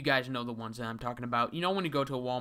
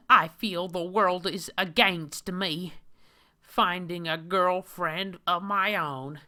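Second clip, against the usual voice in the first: high, loud mocking nerdy whine; hammed-up high whiny man voice; 7 semitones higher, 9 dB louder than usual. Reads the comic hero's own words.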